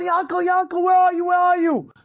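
high, insistent calling shout; screaming out. Retold or imitated. Friend going around looking for Yanko, screaming out. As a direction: holler the name as if searching a crowd. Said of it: "and he screams out".

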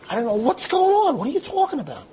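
high-pitched, agitated bewilderment; exasperated protest. Retold or imitated. Accused cyclist explaining himself to the policeman, exasperated. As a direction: plead ignorance, pitch shooting up.

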